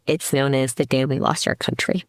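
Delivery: ordinary delivery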